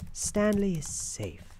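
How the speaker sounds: ordinary delivery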